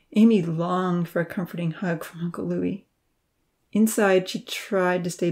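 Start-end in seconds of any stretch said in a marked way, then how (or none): none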